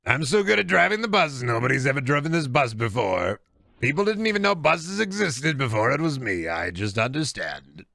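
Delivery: bragging politician impression; Donald Trump. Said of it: "sounded like you were slipping into a trunk. Trump".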